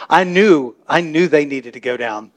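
usual speaking voice

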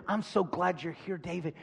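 quiet, easy, companionable voice; friendly welcome. Acted. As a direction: welcome him gently and ask his advice. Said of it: "This voice never says".

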